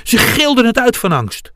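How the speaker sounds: high, alarmed storytelling; heightened narration. A narrator's reading. Narrator tells of the friends' terror, high and alarmed.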